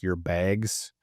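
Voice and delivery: slow, light Canadian accent on "bags". Shown as a demonstration. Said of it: "in my Canadian accent".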